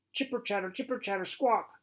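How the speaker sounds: high squeaky squirrel chatter. A narrator's reading. Narrator mimics squirrel noise to the listener, high chattering screech.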